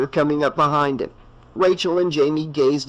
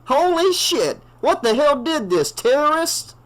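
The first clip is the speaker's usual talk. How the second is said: a much higher, shocked cry; shocked outburst; 13 semitones higher than usual. A narrator's reading. A townie peers inside and cries out in shock. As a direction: recoil at the sight of the bodies.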